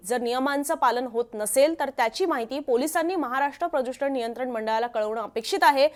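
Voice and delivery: ordinary delivery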